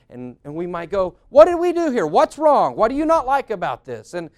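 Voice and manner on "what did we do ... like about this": loud, high, indignant questioning; offended overreaction. Acted. Spouse reading into "it's fine", loud mock indignation. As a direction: fire off hurt, accusing questions.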